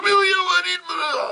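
hammed-up crying shout; mock wailing shout. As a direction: blurt it out in a hysterical wail. Maid wails back.

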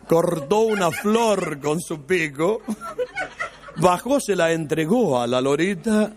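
every word slowly and carefully shaped; slow careful diction. Retold or imitated. Cured Miguelito shows off his diction, slow and careful. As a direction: speak like a cured patient, deliberate.

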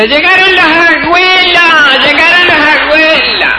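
high, slow, excited shout of welcome; calling out. Retold or imitated. Relatives shouting from inside that the grandparents arrived, high excited shout. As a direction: yell it from inside the house. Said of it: "pegan el grito".

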